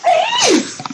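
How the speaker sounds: outraged it-is; indignant shout. Real, not acted. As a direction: insist it hard, voice jumping up. Indignant protest in argument.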